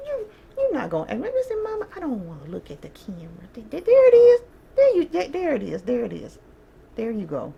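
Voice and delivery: very high, squealy pet voice. Real, not acted. Owner coaxing her dog Dolly to face the camera, squealy baby talk. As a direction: coax the dog sweetly to look.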